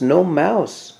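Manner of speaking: ordinary delivery, nothing marked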